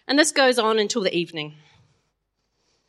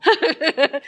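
high laugh; 7 semitones higher than usual. Real, not acted. Laughs after husband impression.

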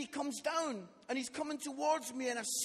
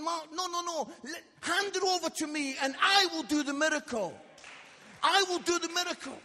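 loud, higher preaching declaration; God's emphatic promise; 5 semitones higher, 9 dB louder than usual. Retold or imitated. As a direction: voice God insisting, building to full force. God urging him, preached with force.

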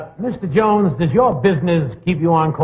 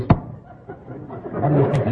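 whispering; 3 semitones lower, 6 dB quieter, much slower than usual. Real, not acted. Panel conference, whispered aside.

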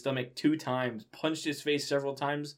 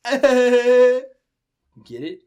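laughing exclamation; 12 semitones higher, 17 dB louder than usual. Real, not acted. Reader laughing at joke.

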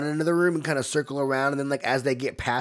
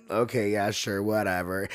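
dismissive; 6 semitones lower, 4 dB quieter than usual. Retold or imitated. Madeline brushes off the offer.

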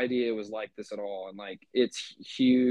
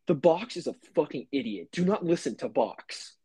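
higher, emphatic quoted warning; mock stern warning; 5 semitones higher than usual. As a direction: warn emphatically, slowing on the last words. Retelling an online warning.

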